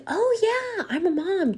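high-pitched, eager agreement; eager mom. Retold or imitated. Woman assuring a flight attendant she will help, eager and high. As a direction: voice a helpful mom, pitched well up. Voices an agreeable mother replying.

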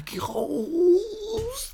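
very high, stretched-out screech; hammed-up squeaky yell of dismissal; squeaky shouting teacher. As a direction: imitate a teacher's voice cracking upward in a yell. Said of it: "immer wenn er geschrien hat".

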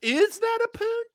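high, slow mock squeal; mocking echo.

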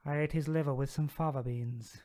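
slow, measured film cannibal; slow villain, rattlesnake menace; Hannibal Lecter. Acted. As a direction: savour each word like a sinister killer. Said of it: "it's usually like this".

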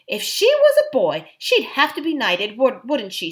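put-on high, excited boyish enthusiasm; eager praise. A narrator's reading. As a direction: gush with admiration. Eustace eagerly praising Jill.

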